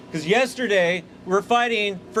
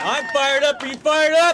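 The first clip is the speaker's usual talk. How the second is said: rousing call, pitched up and loud; crowd-rousing cry to union workers; rally shout; 6 semitones higher, 4 dB louder than usual. Real, not acted. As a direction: fire up the crowd with a shouted question.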